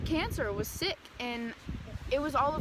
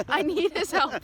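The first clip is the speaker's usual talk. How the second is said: pleading; 3 semitones higher, 7 dB louder than usual. Retold or imitated. Pleading to teacher, then repeated.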